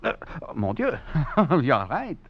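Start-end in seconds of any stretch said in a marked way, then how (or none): 1.0-1.6 a short rising laugh of delight; delighted chuckle. Acted. Duplessis chuckles over the beautiful knife, delighted. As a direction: chuckle with pleasure at the blade.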